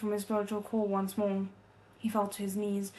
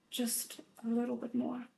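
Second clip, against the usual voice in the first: softer, pushing through the effort; a strained, softer inner plea; strained hush; 7 dB quieter than usual. A narrator's reading. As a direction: murmur it to yourself through pain. Hero straining, inner thought.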